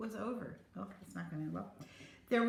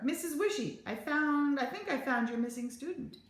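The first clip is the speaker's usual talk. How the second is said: calling over; 7 semitones higher than usual. A narrator's reading. Captain calls to teacher.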